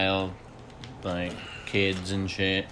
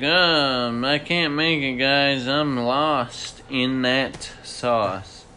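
higher-pitched mock excuse, last words drawn out; imitating absent friend; 4 semitones higher, 3 dB louder, much slower than usual. Friend begging off to the guys, mock whine. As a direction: play up a friend's lame excuse. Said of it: "He's like".

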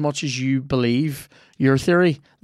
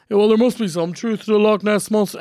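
mock believer; 7 semitones higher than usual. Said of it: "it's like".

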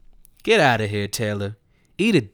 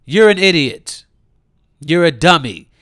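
ordinary talk

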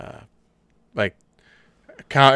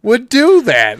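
laughing disbelief; 8 semitones higher, 6 dB louder than usual. Real, not acted. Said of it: "I'm laughing so hard".

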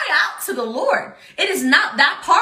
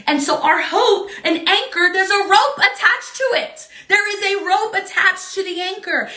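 rising, fervent preaching voice; preaching at full force, pitch climbing; 7 semitones higher than usual. Acted. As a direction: lift them with the anchor image. Preaching builds on anchor.

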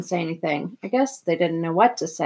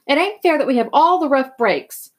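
raised pitch, heated complaint; passionate cry; 6 semitones higher, 4 dB louder than usual. A narrator's reading. Boy protesting their rough luck, passionate. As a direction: protest with feeling, leaning on key words. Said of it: "I cried passionately".

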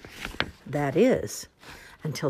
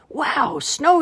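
high, loud cry of wonder; excited yell; 11 semitones higher, 6 dB louder than usual. A narrator's reading. Groundhog seeing the first snow, excited yell. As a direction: yell it out with delight, pitch way up. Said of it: "yelled Groundhog".

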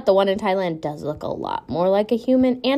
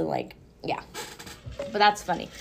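sipping a drink mid-sentence; drinking while talking, muffled; 2 semitones lower, 8 dB quieter than usual. Real, not acted. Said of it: "sorry, I'm drinking my Monster".

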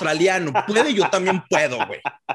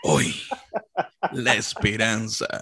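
slow, low announcer voice; dramatic campaign ad narration; political ad announcer; 10 semitones lower, slower than usual. Retold or imitated. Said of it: "Y sale el vato acá".

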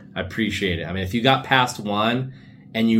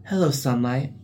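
high, soft, sing-song hello; mock dreamy greeting; 7 semitones higher, 4 dB quieter than usual. Waking up and greeting the sunlight in a dreamy voice. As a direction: greet the sun like a dreamy romantic. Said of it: "I was like".

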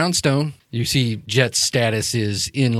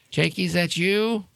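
higher, lilting call from another room; another household voice; 7 semitones higher, 4 dB quieter than usual. Acted. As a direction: call out sweetly pitched up, asking who's there. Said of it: "you hear another voice say".

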